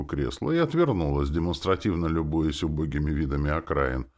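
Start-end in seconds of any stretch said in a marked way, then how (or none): none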